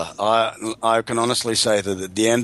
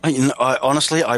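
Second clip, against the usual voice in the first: ordinary talk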